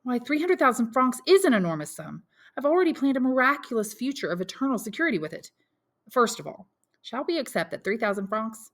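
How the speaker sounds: mother fearing for her son's future; voiced mother Mercédès.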